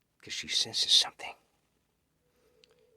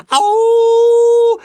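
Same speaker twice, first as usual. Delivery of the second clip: battle cry; 20 dB louder than usual. Acted. Hundreds of humans burst in.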